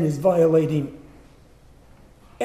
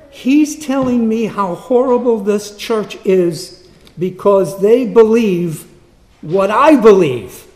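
higher and louder, slow, building outrage; rising indignation; 5 semitones higher, 8 dB louder, slower than usual. Retold or imitated. A patient retelling a pastor's attack, building indignation. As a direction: let the anger climb word by word.

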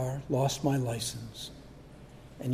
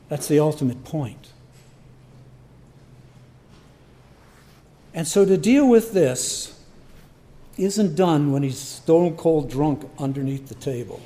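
forceful preaching; 3 semitones higher, 7 dB louder than usual. Acted. Pastor preaching to his congregation about drunkenness, forceful and raised.

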